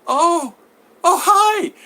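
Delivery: high, flustered oh-hi; startled woman. Retold or imitated. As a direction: caught out, squeak a surprised hello. Woman caught off guard.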